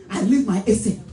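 ordinary talk, nothing marked

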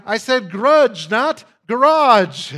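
loud, slow, high "grudge" then drawn-out "garage"; mock insistent woman. Woman correcting her attorney, loud slow insistence. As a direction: spell it out loud like an exasperated client. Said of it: "like that woman speaking to the attorney".

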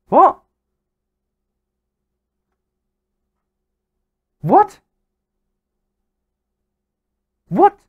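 shocked cries as the goal is disallowed; incredulous what. Real, not acted. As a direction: yelp in total disbelief.

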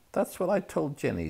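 put-on stern older brother scolding; fiercely. A narrator's reading. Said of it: "said Percy fiercely".

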